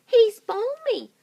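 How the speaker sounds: very high, loud mocking list; roly-poly bird teasing Mugglewump. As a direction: tease like a silly bird. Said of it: "cried the roly-poly bird".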